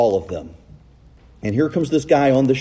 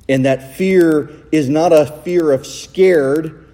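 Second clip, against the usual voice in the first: ordinary talk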